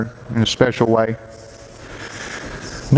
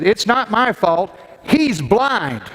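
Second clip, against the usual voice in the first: slow and high, laughing through it; laughing mother; 8 semitones higher, much slower than usual. Retold or imitated. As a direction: quote her defiant excuse, slowed, with a laugh. Mother blaming the other driver.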